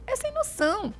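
exaggerated mineiro emphasis; emphatic demo. Shown as a demonstration. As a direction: pitch "É sem noção" up high.

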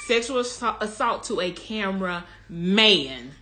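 "cameraman" drawn out slowly; emphatic repeat. Real, not acted. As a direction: repeat it for effect, stretching "cameraman". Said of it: "Let me say it again".

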